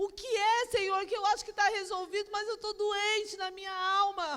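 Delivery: remembered weeping plea to God; retold tearful prayer. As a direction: cry out to God as you recall it. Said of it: "E eu chorei na presença de Deus".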